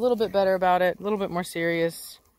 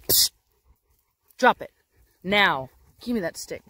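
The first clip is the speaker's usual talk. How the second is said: firm command. Real, not acted. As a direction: order the dog to drop it. Commanding possessive dog.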